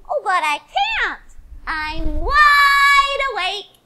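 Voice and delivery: high, slow tiny bird voice; sing-song baby bird reply. Acted.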